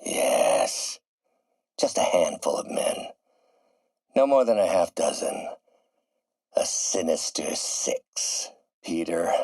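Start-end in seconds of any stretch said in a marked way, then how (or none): 0.0-1.0 very low, drawn-out villainous approval; sinister relish. Acted. Villain relishes his forming scheme. 6.6-8.5 low, slow, menacing pronouncement; name the gang slowly, savouring the menace; sinister relish. Acted. Villain names his planned gang.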